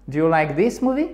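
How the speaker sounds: "Do you like this movie" climbing at the end; rising intonation. Shown as a demonstration. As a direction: ask it with pitch going up on "movie". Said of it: "montante sur la fin". (« Do you like this movie », intonation montante sur « movie »)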